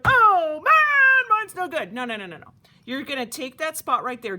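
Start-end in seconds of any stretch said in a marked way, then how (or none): 0.1-1.9 loud, high mock wail of a disappointed kid. Teacher acting out a camper whose teapot print has a gap, loud mock wail. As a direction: ham up a kid upset that the print failed. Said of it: "don't be like".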